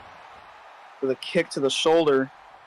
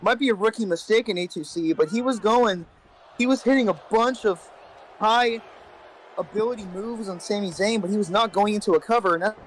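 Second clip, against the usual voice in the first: raised, lively ringside commentary voice; wrestling commentator; 4 semitones higher than usual. Acted. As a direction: call the match like a commentator. Holds a live play-by-play.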